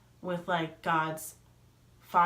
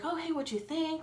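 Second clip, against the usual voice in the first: higher-pitched voice for a quoted friend; acted friendly greeting; 9 semitones higher, 4 dB quieter than usual. Friend asking her how the show went, high acted greeting. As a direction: imitate a friend's high, soft question.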